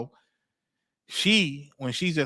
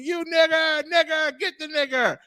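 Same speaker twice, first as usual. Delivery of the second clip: retold scream, pitched way up; imitated screaming; 11 semitones higher than usual. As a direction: imitate a screaming mom without full volume. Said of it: "when mom just screaming".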